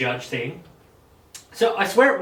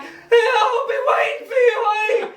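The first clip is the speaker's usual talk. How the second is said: much higher, louder mock cry with laughter; dramatic father; 6 dB louder than usual. Father to his daughter being taken away, hammed-up high laughing cry. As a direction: overact a tearful farewell. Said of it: "fucking dramatic shit".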